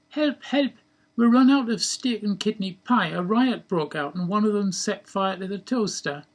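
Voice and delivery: high, loud call for help; mock panicked cry. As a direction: play up a silly emergency, voice pitched high. Acts out a mock emergency call.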